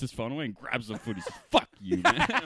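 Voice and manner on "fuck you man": loud, high comic outburst; high-pitched mock yell; mock hostile yell. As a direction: blurt it as a punchline, laughing. Punchline voicing of food video guy.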